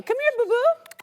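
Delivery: high-pitched pet-calling coo; real sweet talk to a pup; calling a dog. Real, not acted. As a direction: call the dog over, high and loud.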